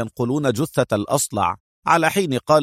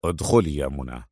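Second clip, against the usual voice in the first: low, soft, composed call; calm; 9 semitones lower, 5 dB quieter than usual. A narrator's reading. Adham lets Mona into his room, calmly. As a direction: invite her in quietly and evenly. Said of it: "فقال بهدوء".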